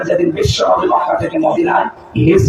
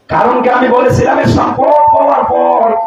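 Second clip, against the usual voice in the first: impassioned preaching; 8 semitones higher, 3 dB louder than usual. Real, not acted. Preacher warning the congregation about wealth, impassioned preaching. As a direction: preach it out with raised pitch.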